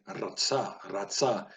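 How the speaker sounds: "ratsa" with a rolled R on the tongue tip; trilled R. Shown as a demonstration. Teacher offering learners an alternative pronunciation, trilled R. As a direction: roll the R at the start of each "ratsa". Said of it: "trilling the R".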